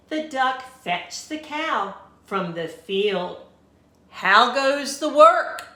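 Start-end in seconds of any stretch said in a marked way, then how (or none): 4.2-5.7 loud, high call from bed; lazy farmer shouting. A narrator's reading. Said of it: "called the farmer".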